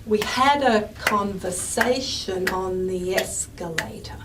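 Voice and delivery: slow, evenly clapped beat on every stress. Shown as a demonstration. Teacher clapping the sentence rhythm slowly. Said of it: "if I had to clap this sentence".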